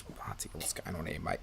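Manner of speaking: breathless whispered aside; unvoiced whisper to yourself. Real, not acted. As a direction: mutter it under your breath. Muttered aside to himself.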